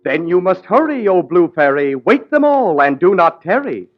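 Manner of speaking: ordinary delivery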